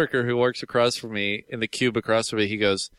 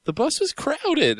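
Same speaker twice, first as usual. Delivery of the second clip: other passenger's reply; 12 semitones higher, slower than usual. Retold or imitated. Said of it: "the guy's like".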